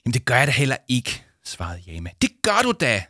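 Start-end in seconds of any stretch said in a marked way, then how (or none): 0.0-1.2 quick, louder, higher retort; fast protest in a quarrel; defensive denial. Acted. Acted quarrel, Jama denies it. 2.2-3.0 high, loud snappish retort; biting, raised-pitch comeback. Acted. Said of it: "svarede Victoria bisk".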